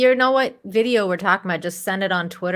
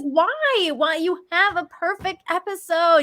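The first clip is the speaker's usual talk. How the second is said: high-pitched exasperated plea; exasperated fan's lament, pitched way up; 8 semitones higher than usual. Retold or imitated. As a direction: whine it in high disbelief, retelling your own frustration. Retold exasperation at a missed episode.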